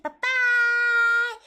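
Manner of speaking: high, loud squeal; high squeaky goodbye. Pretend. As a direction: squeal it out cutely. Sign-off squeal.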